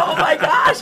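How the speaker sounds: loud, high gasped exclamation; big stunned outburst; acted astonishment. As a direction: re-enact being floored, half laughing. Retold shock at the news.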